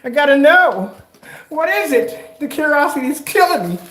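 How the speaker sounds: high, loud voice for a nosy cat; curious cat. Acted. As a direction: act out a cat bursting to know. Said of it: "he's as curious as curious".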